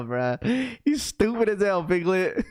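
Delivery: usual speaking voice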